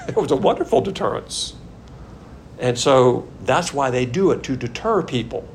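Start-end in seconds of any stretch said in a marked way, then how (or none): 0.0-1.5 chuckling, higher-pitched remark; amused chuckle. Real, not acted. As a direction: say it through a small laugh. Chuckles at his own remark.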